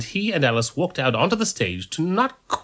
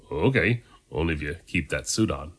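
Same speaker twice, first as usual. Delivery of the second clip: usual speaking voice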